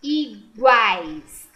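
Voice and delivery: teacher separating "Iguais" aloud; split into syllables. Shown as a demonstration. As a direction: pull "Iguais" apart to show the split.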